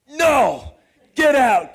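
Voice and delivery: loud, high mock yell. New York shouting the failed newcomer out, loud mock yell. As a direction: bark him out of the room.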